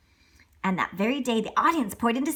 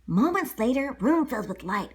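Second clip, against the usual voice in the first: plain reading voice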